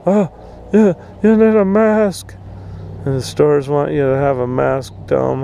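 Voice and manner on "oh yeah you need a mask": mock the whiners with a nasal sneer. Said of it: "whining and bitching about people".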